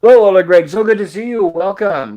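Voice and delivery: excited greeting. Real, not acted. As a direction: greet him with a big bright shout. Greeting a newcomer in chat.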